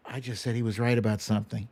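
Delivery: gasp, then a fast hushed aside; mock shock. Catching herself agreeing with Trump, a gasped quick aside. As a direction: catch yourself in fake horror, quick and quiet.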